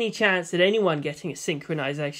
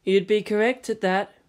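ordinary delivery

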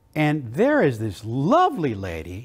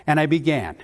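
normal talking voice

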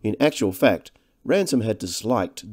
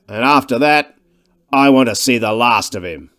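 louder, hostile professor; irritated scientist; 8 dB louder than usual. Acted. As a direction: brush off the intruder with contempt. Weston's lines in the novel.

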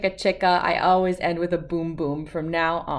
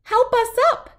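high, louder little-voice plea; squeaky cry for help; 5 dB louder than usual. Acted. Fallen letters A B C calling for help, high squeaky plea. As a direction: call up like tiny letters in a heap.

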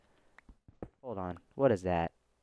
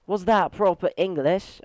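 put-on higher, playful British accent; 6 semitones higher, slower than usual. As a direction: ask it in a mock British accent. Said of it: "if I say that in a British accent".

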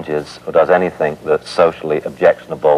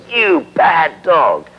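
mock scolding; 9 semitones higher, much slower than usual. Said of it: "they grab the dog and say".

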